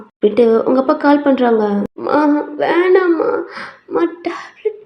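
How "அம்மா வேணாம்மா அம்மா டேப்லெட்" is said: high feeble whimper; sick girl pleading weakly with Mum; tearful refusal. Acted. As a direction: whine it faintly, pitch raised.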